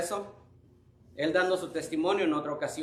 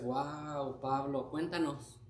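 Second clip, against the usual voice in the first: soft, hushed amazement; softly wowed, asking for more; awed congregation; 5 dB quieter, slower than usual. Acted. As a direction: play listeners quietly marvelling at a testimony. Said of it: "Y ustedes".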